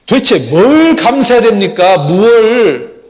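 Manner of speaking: acted indignant shout, high and loud; exasperated wife demanding answers, hammed-up; indignant arguing. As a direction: have it out with God at full voice. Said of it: "또 하나님께 따졌답니다".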